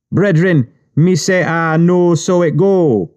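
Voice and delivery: high Jamaican patois voice; slow Jamaican patois drawl; Jamaican accent. Retold or imitated. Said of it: "somebody says outside in a Jamaican accent".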